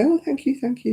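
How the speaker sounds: grateful thanks. Retold or imitated. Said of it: "And they say".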